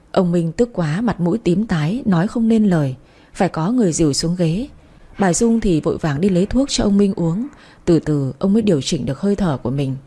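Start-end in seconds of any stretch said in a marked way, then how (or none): none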